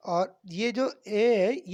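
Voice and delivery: slow, drawn-out 'ए' sound; stretched vowel. Shown as a demonstration. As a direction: lengthen the 'ए' for learners. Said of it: "ये बड़ी आवाज़ निकलेगी".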